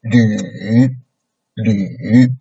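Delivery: falling-rising LÜ; third tone. Shown as a demonstration. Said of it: "So you make it down first".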